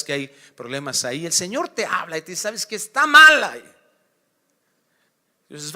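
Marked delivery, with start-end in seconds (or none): 2.4-3.7 loud divine rebuke. Acted. God's voice speaking to you.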